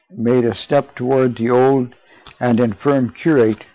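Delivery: usual speaking voice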